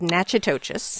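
Natchitoches as NATCH-ih-TOE-chess; wrong pronunciation. Real, not acted. Host repeating the botched name to Robert, mispronounced. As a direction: pronounce Natchitoches as it is spelled, with ch sounds. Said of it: "that's not right. It's wrong".

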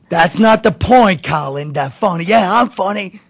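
loud, high, slow tirade; old comic screaming at Colin, loud and high; angry yelling. Retold or imitated. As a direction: bark it back at him, slow and loud. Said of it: "He starts screaming at me".

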